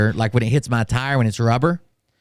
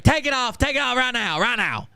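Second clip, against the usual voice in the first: mock screamed order; 12 semitones higher than usual. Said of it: "started screaming so loud like that".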